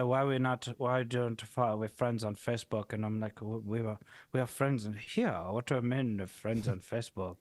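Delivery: lightly put-on French accent; a hint of a French guy's voice. Retelling a Facebook chat to friends, light French accent. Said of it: "your accent sounds exactly like the guy".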